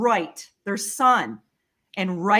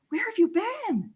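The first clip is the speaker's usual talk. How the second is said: high-pitched retold bewildered question; incredulous questioning; 10 semitones higher, 4 dB quieter than usual. Friend finding her after hours missing, imitated high incredulous question. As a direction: mimic a friend's puzzled demand in a raised pitch.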